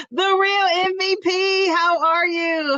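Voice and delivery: high, slow, drawn-out hello; light up as she spots her favourite; excited greeting. Real, not acted.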